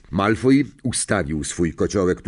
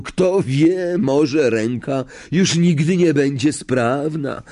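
high, sighing mock lament; milk the injury theatrically; exaggerated affectation; 7 semitones higher, 3 dB louder, slower than usual. A narrator's reading. Malfoy laments his injured arm, with exaggerated affectation. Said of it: "westchnął z przesadną afektacją".

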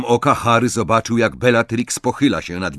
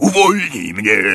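loud, raised croaking plea; croaked demand; 5 semitones higher, 5 dB louder than usual. A narrator's reading. Pinned Greyback wants Bellatrix to free him, croaking. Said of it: "wychrypiał Greyback".

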